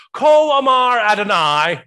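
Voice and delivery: put-on Hebrew intoned in a Jamaican accent, high and loud; booming Jamaican-accented declaration. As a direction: proclaim it like a Jamaican professor. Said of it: "I learned how to say this in Hebrew with a Jamaican accent".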